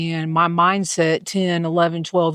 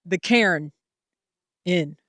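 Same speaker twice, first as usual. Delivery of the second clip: southern cairn; 2 semitones lower, slower than usual. Shown as a demonstration. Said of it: "that's my southern version".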